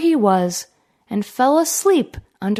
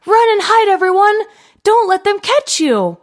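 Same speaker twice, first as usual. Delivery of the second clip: put-on high, loud panicked kid's call; frightened cry; 10 semitones higher, 3 dB louder than usual. A narrator's reading. Little goat warns siblings, high panicked shout. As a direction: scream a warning to the others. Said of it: "One of them cried".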